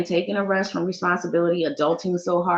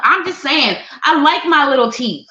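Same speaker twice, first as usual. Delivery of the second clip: usual speaking voice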